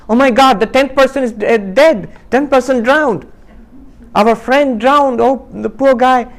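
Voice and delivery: high, loud alarm at the missing friend; panicked friend. Acted. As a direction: cry out that the tenth man drowned. Friend finds one missing, panics.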